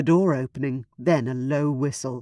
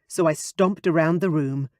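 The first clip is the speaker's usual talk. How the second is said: ordinary delivery, nothing marked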